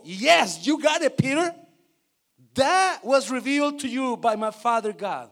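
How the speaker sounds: loud, triumphant affirmation; acted praise. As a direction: congratulate a pupil who got it right. Voices Jesus praising Peter in English.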